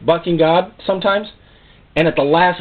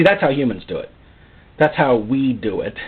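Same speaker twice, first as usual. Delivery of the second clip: usual speaking voice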